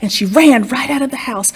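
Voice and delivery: ordinary delivery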